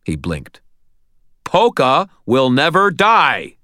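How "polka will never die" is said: slow, raised rallying shout; high, loud, drawn-out battle cry. A narrator's reading. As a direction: declare it loudly, stretching every word. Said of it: "I shouted at him".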